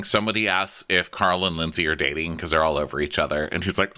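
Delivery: ordinary talk, nothing marked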